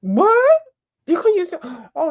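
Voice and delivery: high surprised yelp. Real, not acted. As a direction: pop up in surprise. Surprise at screen.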